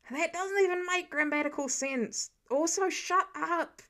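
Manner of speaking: exasperated, snapping back; annoyed retort. Acted.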